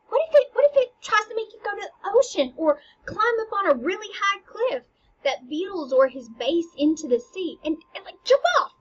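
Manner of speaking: high, rising, alarmed warning. Pretend.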